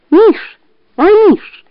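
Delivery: fox shouting. A narrator's reading. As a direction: shout up high to wake him. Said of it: "крикнула она".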